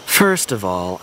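ordinary delivery

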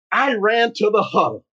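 usual speaking voice

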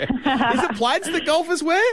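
high, slow, laughing through it; laughing question. Real, not acted. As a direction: giggle the question out. Joking about golf fashion.

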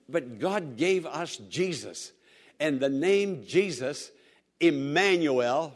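slow, soft and reverent. Acted. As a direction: let each name settle slowly. Solemn slow name of Jesus.

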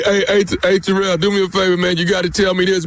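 ordinary talk

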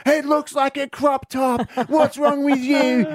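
high, drawn-out furious wail; imitated outrage. Daughter blames dad for the crop top, mock outraged shout. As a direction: stretch each phrase out in high disgust.

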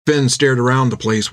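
usual speaking voice

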